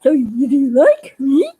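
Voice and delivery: slow, hammed-up weepy puppet; a touch of fake crying, drawn out; mock tearful. Puppet Billy Bob asks the fans if they like him, mock tearful. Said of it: "I don't really talk like that much".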